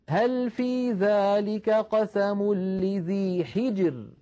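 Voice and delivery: very slow chanted verse, light ra in حجر; slow tajweed recitation ending on a light ra; Quranic recitation. Shown as a demonstration. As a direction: draw the verse out melodically. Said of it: "لاحظوا كلمة حجر".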